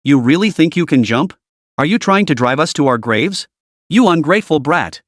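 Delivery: ordinary delivery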